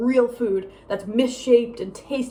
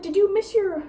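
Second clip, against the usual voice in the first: high-pitched imitation of mom's questioning; mock high, probing mom voice; inquiring mom; 9 semitones higher than usual. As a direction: mimic mom checking up in a raised voice. Mimics mom asking about class.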